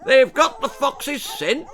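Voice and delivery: high, loud hunting bellow. A narrator's reading. Lord Percy bellowing to his hunt. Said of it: "bellowed Lord Percy".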